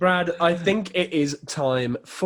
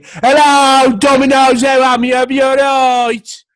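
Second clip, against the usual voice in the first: high, loud, slow yokel receptionist; broad local phone voice; 10 semitones higher, 12 dB louder, slower than usual. Pretend. Mocking a Salisbury receptionist on the phone, loud high put-on voice. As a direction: ham up a clueless receptionist answering. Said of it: "answering the phone and going".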